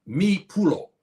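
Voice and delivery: "mi pulo" said in Fulani, each syllable clear; Fulani phrase. Shown as a demonstration. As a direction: give "mi pulo" its Fulani sounds. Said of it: "when you say".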